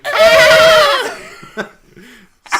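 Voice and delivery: big burst of high laughter; loud laughter. Real, not acted. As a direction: roar with laughter. Explosive shared laughter.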